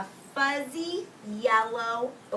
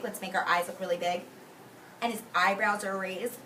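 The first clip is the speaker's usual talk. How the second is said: usual speaking voice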